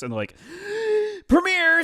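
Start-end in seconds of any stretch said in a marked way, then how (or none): 0.4-1.2 high dramatic gasp; excited gasp. Pretend. Mock YouTube excitement. 1.3-1.8 high, loud thrilled shout; excited announcement. Pretend. Mock YouTube excitement.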